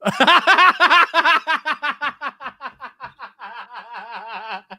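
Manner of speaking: long real laugh. Real, not acted. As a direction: burst out laughing and keep it going. Cracking up over the spelling.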